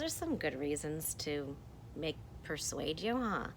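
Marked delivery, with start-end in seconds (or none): none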